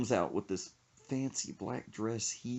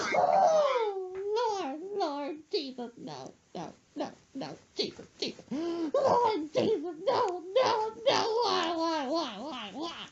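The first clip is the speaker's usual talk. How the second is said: high falsetto sobbing that fades; mock blubbering; much slower than usual. Son imitating mom crying on the floor, high falsetto sobbing. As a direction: wail to Jesus, sinking to a whimper. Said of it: "blubbering, crying".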